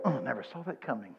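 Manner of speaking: mock dismay. Preacher mimicking a God caught unawares, mock dismay. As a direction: act caught off guard, under your breath. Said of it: "God's not up there going".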